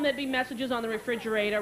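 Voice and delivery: ordinary delivery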